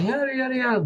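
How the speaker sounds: high, soft, dismissive yada; airy high "yada, yada"; mock whiny yada. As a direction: brush it off in a high, soft whine. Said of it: "I'd be like".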